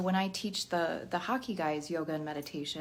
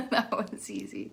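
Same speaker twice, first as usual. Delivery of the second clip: laughing; 4 semitones higher, 4 dB louder than usual. Real, not acted. Repeated through laughter.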